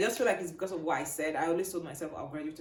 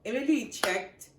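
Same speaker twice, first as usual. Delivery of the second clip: ordinary delivery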